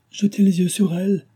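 high-pitched distressed exclamation; urgent cry. A narrator's reading. Henriette urging the doctor to look at the patient, urgent cry. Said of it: "s'écria-t-elle".

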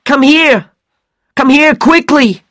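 very high, loud screaming call; urgent shout. A narrator's reading. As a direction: scream for the others to come. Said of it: "Regan shouted".

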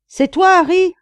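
high, loud call back, rising at the end; shouted question. A narrator's reading. Friend down the shaft answering a call, shouting. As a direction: holler up to check who is there.

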